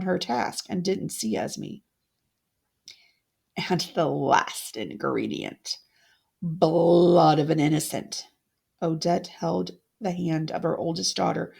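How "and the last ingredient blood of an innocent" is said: low, eerie witch voice over the cauldron; witch incantation. Acted. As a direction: intone it like a sorceress finishing a spell. Witch brewing a potion.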